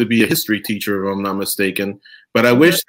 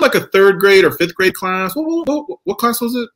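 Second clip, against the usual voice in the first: higher pitched fumbling question; flustered; 7 semitones higher than usual. Real, not acted. Host groping for the grade he forgot, flustered. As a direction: stumble as the memory slips away.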